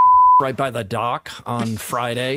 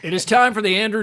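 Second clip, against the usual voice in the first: radio announcer; 6 semitones higher, 5 dB louder, faster than usual. Acted. Announces the show's audio drop.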